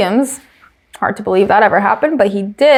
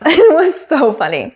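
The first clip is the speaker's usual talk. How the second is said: higher voice through a real laugh; 5 semitones higher than usual. Real, not acted. Host reacts to Toto's comeback, laughing. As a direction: still giggling at the joke.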